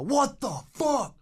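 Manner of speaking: high, drawn-out mock cry of disbelief; freaked-out stranger. Voice from the corner at midnight, freaked out. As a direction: imitate a slow, high-pitched freak-out. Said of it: "you hear this voice in the corner go".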